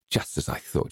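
soft, almost whispered told-you-so; hushed and knowing; quiet grim satisfaction. A narrator's reading. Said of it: "she said".

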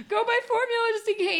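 high-pitched mock panic; retold frantic order. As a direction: act out an urgent order to husband.

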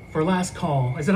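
normal talking voice

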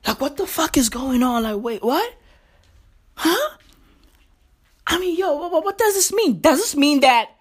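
baffled, rising questions; mock confusion. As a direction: stammer it out, totally lost.

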